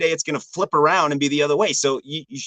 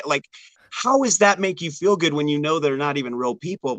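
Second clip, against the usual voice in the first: usual speaking voice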